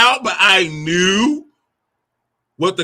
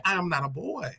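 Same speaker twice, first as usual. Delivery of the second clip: inner voice; 11 dB quieter than usual. Retold or imitated. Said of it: "inside, I'm like".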